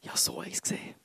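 near-whisper, very soft; hushed confiding. Retold or imitated. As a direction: drop the voice away almost to nothing. Hushed telling of his vision.

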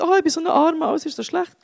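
high mock whine of self-pity; whiny moaning. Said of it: "der Hiob jammert und sagt".

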